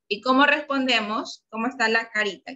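ordinary talk

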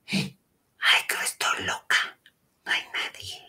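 hushed, voiceless whisper; barely audible self-reassuring whisper; retold whisper to self. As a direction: whisper as if alone in the dark, fading almost to nothing. Whispering to herself in bed.

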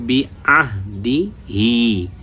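বি, আহ, দি, হি spaced apart, হি held long; syllable by syllable. Shown as a demonstration. Teacher showing how to pronounce it, বি আহ দি হি piece by piece. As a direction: separate each piece, stretch the final হি. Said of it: "আমরা উচ্চারণ কি করব".

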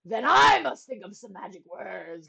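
loud, lightly acted royal groan; a hint of groan, hitting the opening hard; groaning king. A narrator's reading. Stuck king forced to find magic words, groaning. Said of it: "groaned the king".

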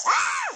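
sudden high-pitched yelp; startled scream. Real, not acted. Gamer startled by something gross in game, high yelp. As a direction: let out a short, high shriek.

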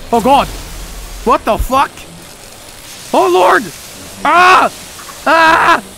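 frightened high-pitched yelps; panicked screams at a jump scare; scared horror game streamer. Real, not acted.

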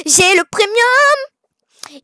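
breathless, thrilled exclamation; excited gasp. Real, not acted. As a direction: gasp then cry out with delight. Gasping at buying premium.

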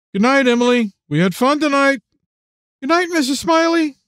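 high, sing-out goodbye; cheery good night. A narrator's reading. Friend and girl parting after bowling, high cheery farewell.

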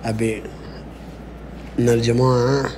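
deep pretend man's voice. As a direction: drop the voice low like a grown man. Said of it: "غير صوتك".

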